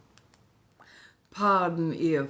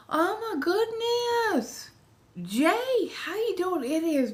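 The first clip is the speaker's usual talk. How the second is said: delighted greeting; 14 semitones higher than usual. Real, not acted. Streamer greeting Jay after forever, high delighted voice. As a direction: greet them in a soaring, excited pitch.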